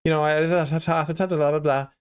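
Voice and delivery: very high-pitched salesman chatter; exaggerated high telephone pitch; high phone sales voice. Shown as a demonstration. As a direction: push the pitch way up like an eager cold caller. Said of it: "that's how I used to sound".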